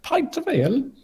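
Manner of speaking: high-pitched cartoon British voice; mock British accent. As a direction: pipe up in a put-on British accent. Joining the British accent bit.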